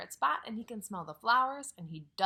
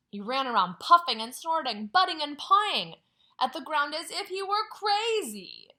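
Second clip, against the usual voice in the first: excited; 5 semitones higher, 10 dB louder than usual. A narrator's reading. Bull goes wild in story.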